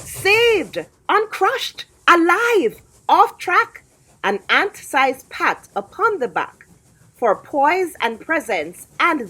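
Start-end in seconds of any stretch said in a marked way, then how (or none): 0.1-3.8 high, jubilant relief; relieved triumph. A narrator's reading. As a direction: savour each word of the rescue. Ant escapes the train.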